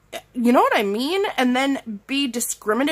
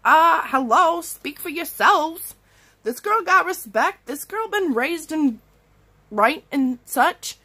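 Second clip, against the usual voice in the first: higher, sassy, offended clapback; sassy comeback; 6 semitones higher than usual. Retold or imitated. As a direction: put the grown-ups in their place. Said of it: "And I'd just be like".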